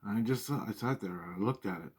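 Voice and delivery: ordinary delivery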